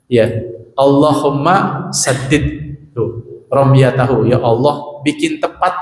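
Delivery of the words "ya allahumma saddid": slow prayer recital. Shown as a demonstration.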